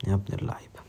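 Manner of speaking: a stunned whispered compliment; awed whisper. Real, not acted. Gamer whispers praise at the screen. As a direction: breathe it out, almost silent.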